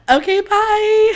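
high, loud, drawn-out bye; singsong goodbye. Real, not acted. Host bidding podcast listeners farewell, high and loud singsong.

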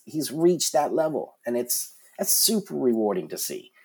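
ordinary delivery

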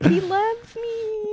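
very high, slow, soft squeal; mock swooning. Host acts being flattered by co-host, high drawn-out squeal. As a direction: stretch it out in a tiny high voice. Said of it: "I was like".

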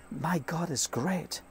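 very soft, slow reverence; quiet, unhurried praise; hushed awe. Real, not acted. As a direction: murmur it in wonder. Pondering God's greatness at the window.

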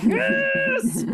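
a high, thrilled squeal of yes; overjoyed, can't believe his luck; eager yes. Acted. Hero eagerly agreeing to her plan.

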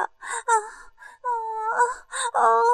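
high repeated moans; acted moans. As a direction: moan high between phrases. Acted moans in erotic scene.

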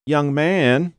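high whiny call to a stranger; whiny woman. A narrator's reading. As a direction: stop him with a high, drawn whine. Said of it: "the whiny voice stopped him".

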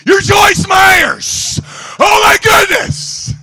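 high, loud, slow starstruck shout; hammed-up fan freakout; freaking out. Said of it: "Freaking out".

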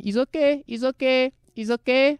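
high-pitched, repetitive placating voice; imitated worker. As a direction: mimic the caught worker insisting in a high voice. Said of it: "he's holding his hands at his side going".

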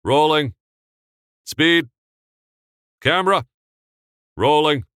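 loud high crisp crew calls; set calls. Acted. Crew calling out cues before the take, loud. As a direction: call each cue sharply.

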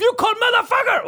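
high-pitched outburst of cursing; comic screamed accusation; mock angry yell. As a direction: imitate an enraged scammer shouting. Imitating the scammer losing his temper.

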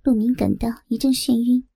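ordinary delivery

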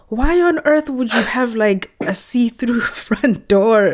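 high, amused disbelief at a see-through door; incredulous inner thought. Retold or imitated. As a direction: voice rising in baffled surprise, a laugh slipping in. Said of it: "And I'm thinking".

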